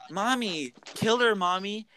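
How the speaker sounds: sing-song high voice, imitating a child. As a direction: beg Mommy in a put-on little voice. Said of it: "she does it in, like, a higher voice".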